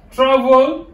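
teacher's emphatic "Travel", first syllable stressed; stress demonstration. Shown as a demonstration. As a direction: model "Travel" firmly, leaning on its first syllable. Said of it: "l'accent peut travel".

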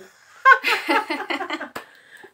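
high, very loud laughing fit; real laughter, pitched high. Real, not acted. Laughing at own TV job joke.

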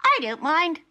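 squeaky very high little creature voice; Bert the Tumbledowny. Acted. Bert greets Polly innocently, squeaky very high. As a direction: squeak it out cheerily.

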